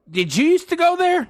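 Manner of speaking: very high, loud mock question; imitating nosy stranger. Woman polling people in line, high mock question. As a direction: ask it high and pushy, as her.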